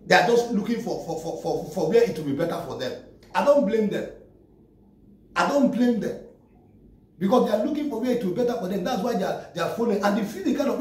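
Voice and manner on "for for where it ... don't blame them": normal talking voice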